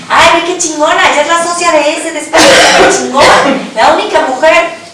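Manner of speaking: high, sarcastic gushing quote; mock admiration. Speaker mimics how others praised her success in a high, mock-gushing tone. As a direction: gush with fake praise, lightly mocking. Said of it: "no es por".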